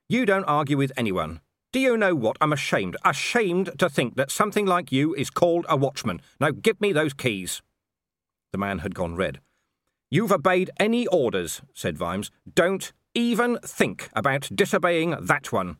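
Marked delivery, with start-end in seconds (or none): 0.1-7.6 indignant, pitched up in bursts; angry dressing-down. Acted. Commander shaming a watchman over his missing wife, angry. 10.1-11.6 high, loud rebuke; angry accusation. Acted. Commander rebuking an obedient watchman, angry. 12.5-15.8 high, slow, emphatic threat; angry warning. Acted. Commander demanding the keys from a watchman, angry warning.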